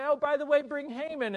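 high, eager acted aside; excited king. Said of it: "The king's all excited and say".

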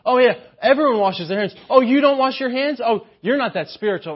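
high, sing-song and condescending; snooty Pharisee. Retold or imitated. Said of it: "this idea of".

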